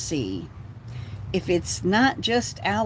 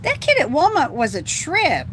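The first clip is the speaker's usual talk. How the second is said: high, loud burst of amused disbelief; loud amazed outburst; excited exclaim; 9 semitones higher, 6 dB louder than usual. Real, not acted. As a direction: blurt it out laughing at the memory. Sudden exclaim about kid.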